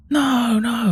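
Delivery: very high-pitched wail of disbelief, imitated; dad's drawn-out, stunned cries of denial; retold shocked cry.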